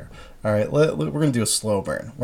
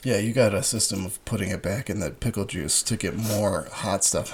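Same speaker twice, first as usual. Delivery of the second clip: ordinary talk, nothing marked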